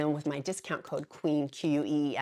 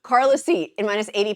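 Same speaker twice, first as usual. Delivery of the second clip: Carla C called out higher and louder; louder restart; 4 semitones higher, 10 dB louder than usual. Shown as a demonstration. Vlogger restarting the name after catching herself, louder and higher. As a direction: announce "Carla C" again with fresh energy. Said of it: "I just said I would do the first name".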